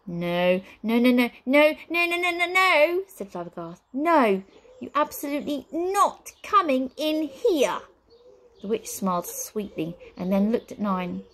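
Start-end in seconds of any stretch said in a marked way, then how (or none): 0.1-3.0 rapid noes climbing higher and louder; frantic refusal. A narrator's reading. Wizard refuses his sister's request, increasingly frantic. 3.9-7.8 high, slow, emphatic indignation; indignant refusal. A narrator's reading. Wizard bars his sister from coming in, indignant.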